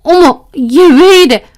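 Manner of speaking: high, loud, shrill-edged teacher voice, rushing at the end; indignant teacher. Retold or imitated. Teacher acting wronged by the student, high and loud. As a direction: play offended innocence, loud. 도리어 황당한 표정을 짓길래.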